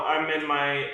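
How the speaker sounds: usual speaking voice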